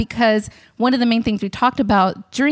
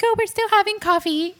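much higher, sing-song; imitating the friend; 11 semitones higher than usual. Fiance asks what she's doing, cheerful. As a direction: lightly voice her cheerful answer. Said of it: "She's like".

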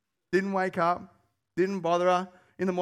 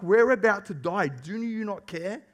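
loud, higher cry of alarm; panicked plea; 4 semitones higher, 4 dB louder than usual. Retold or imitated. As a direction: plead desperately with Jesus. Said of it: "And they're like".

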